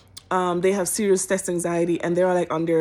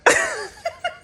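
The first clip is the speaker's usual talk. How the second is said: high laugh. Real, not acted. Incredulous laugh at survey.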